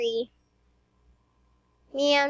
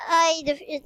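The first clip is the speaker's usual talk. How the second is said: ordinary talk, nothing marked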